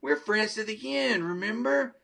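high, wheedling doll voice; pleading Chucky. Retold or imitated. Trapped doll begging the boy by the fireplace, high wheedling. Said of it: "Chucky's like".

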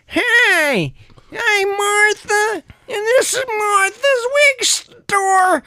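put-on high, slow, quavering old lady; creaky old shopkeeper, slow and high. New character Martha.

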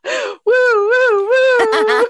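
high, loud party woos; hyped woos. Pretend. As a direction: whoop three times like a game show crowd. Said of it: "so if we get three woos".

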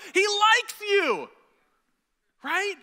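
high, slowed, shouted he likes you; excited shout. Retold or imitated. Pastor acting out reassuring a doubting sister, shouting high and slow. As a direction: pastor reliving telling his church sister God likes her, in a high slow shout.

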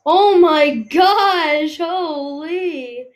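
loud, high, slow oh my gosh; drawn-out astonished cry; shocked. Real, not acted. Shocked at game event.

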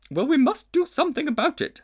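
a grand, ponderous lady elephant; she-elephant. A narrator's reading. She-elephant pondering the strange creature.

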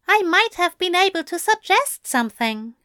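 high, eager angel voice. A narrator's reading. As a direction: offer it high and earnest. Aziraphale speaking.

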